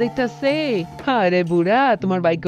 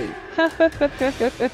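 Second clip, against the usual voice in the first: high gloating laugh; gloating cackle; 4 semitones higher than usual. Acted. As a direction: cackle with greed for the throne. Gloats after wanting the throne. (লোভী খিলখিল হাসি)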